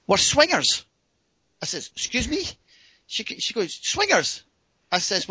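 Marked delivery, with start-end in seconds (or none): 0.1-0.8 high, loud "swingers" falling at the end; imitated blunt reveal. Woman revealing the arrangement to him, imitated high blunt announcement. 1.9-2.4 high-pitched startled query; taken aback. Retold or imitated. Man hearing she's a swinger, retold high-pitched surprise. 3.7-4.4 very high "Swingers" falling at the end; imitated insistent repeat. Woman repeating herself to the baffled man, imitated high insistence.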